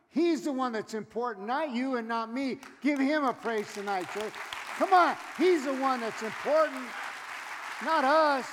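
loud, rising preacher's call; fired-up pulpit preaching; preaching at full force. Acted. Preaching to the congregation.

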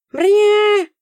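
loud high scornful mew; dismissive meow. A narrator's reading. Cat dismissing Nora, loud scornful mew. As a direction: brush her off with a mew. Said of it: "пренебрежительно отозвалась".

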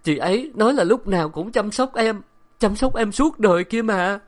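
sobbing. Acted. Wife weeping to her husband, sobbing. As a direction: sob out the words. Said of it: "lại khóc ngất".

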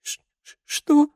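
breathless stammer rising into a high sobbed question; acted sob, very high; sobbing question. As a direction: gasp out the question through a sob. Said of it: "всхлипнула девушка".

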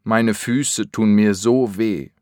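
ordinary talk

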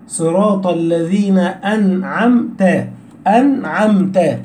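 "أنعمت" slowly, still ن and still م with no added vowel; clean sukun. Shown as a demonstration. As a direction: hold ن and م cleanly closed in "أنعمت". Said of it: "احرص على السكون في أنعمت". ("أنعمت" ببطء، بسكون النون والميم)

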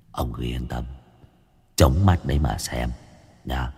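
low, hushed gangster menace; a hint of a gloating thug; Long Nghiện's threat. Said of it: "câu nói của Long Nghiện".

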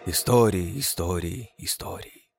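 word fading into a whisper; fading echo. A narrator's reading. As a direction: echo it off into nothing. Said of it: "Эхо отозвалось".

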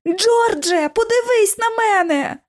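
high desperate cry. A narrator's reading. Lucy cries out to George, high and desperate. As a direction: call out to him in panic. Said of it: "із криком".

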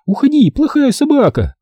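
high cross yell at a dog; scolding shout. A narrator's reading. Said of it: "крикнула Рен".

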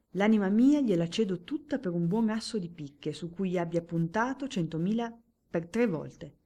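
più alto e più forte, teatrale e beffardo; barone spavaldo. Acted. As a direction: lancia la sfida al diavolo con spavalderia. Barone sfida il diavolo. (barone che invoca il diavolo, spavaldo)

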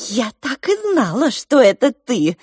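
shrieking. Acted. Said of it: "взвизгнула кухарка".

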